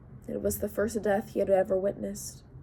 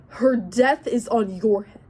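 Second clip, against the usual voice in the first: slow furious accusation; 7 dB louder than usual. Acted. Enraged accusation of Odysseus.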